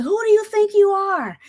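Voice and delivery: high-pitched mock holler; hollering sergeant. Sergeant challenges the prissy new recruit, hollering. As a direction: bellow it as an outraged drill sergeant. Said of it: "he's hollering at me".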